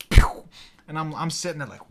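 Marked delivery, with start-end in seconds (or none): 0.1-0.4 sharp "pew" of lights dying; imitated power-cut noise. Storyteller mimics the lights cutting off, vocal sound effect. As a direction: mouth a loud electrical cut-out. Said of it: "Lights cut off".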